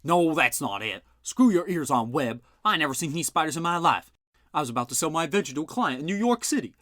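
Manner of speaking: an insulted uncle telling off the sergeant; gruff indignant uncle. A narrator's reading. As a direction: snap back at a dim cop. Bud argues with Webb.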